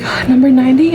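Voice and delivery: exasperated sigh into an order call; retold counter call with mild annoyance; annoyed calling out. Worker calls an order nobody answers, mildly annoyed. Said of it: "And I was so annoyed".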